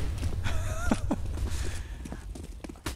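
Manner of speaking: breathless falsetto giggle; high squeaky laugh. Real, not acted. Co-caster cracking up at his own joke, squeaky giggle.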